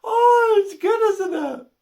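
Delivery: high, loud wail after real laughter; laughed-out delight. Real, not acted. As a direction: still helpless from a laughing fit. Recovering from laughing at own joke.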